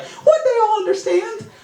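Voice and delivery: put-on high, far kid voice; childlike hope. Remembering self as a kid, high hopeful voice. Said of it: "'Cause I was like".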